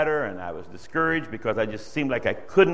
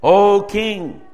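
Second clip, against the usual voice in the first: higher and louder, driving home the pride; fiery preaching; 6 semitones higher, 8 dB louder, much slower than usual. Acted. Preacher confronting the proud king through his listeners, loud and fervent. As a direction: preach it at the king with full force.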